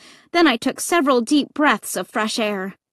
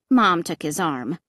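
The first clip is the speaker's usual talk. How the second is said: usual speaking voice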